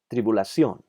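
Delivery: Tribulación with final "ción" stressed; stress on accented syllable. Shown as a demonstration. Teacher showing words with tilde, Tribulación stressed on "ción". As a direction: lean on the last syllable of Tribulación. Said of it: "sílaba que necesita ser enfatizada".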